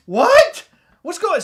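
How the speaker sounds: high, loud bewildered shout; startled. Retold or imitated. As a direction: call back confused. Startled reply.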